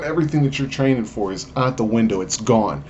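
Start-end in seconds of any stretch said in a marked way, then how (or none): none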